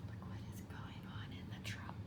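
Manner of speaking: very soft whispered aside. Real, not acted. Reader's quiet aside, whispering. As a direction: whisper it behind your hand. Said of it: "aside in a whisper".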